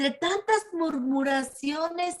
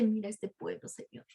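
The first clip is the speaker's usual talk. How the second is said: soft, quiet appeal to God; lightly acted prayer, dropping away; praying; 2 semitones lower, 8 dB quieter than usual. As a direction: plead softly with the Lord. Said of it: "es cuando ora y le dice".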